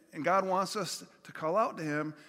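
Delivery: usual speaking voice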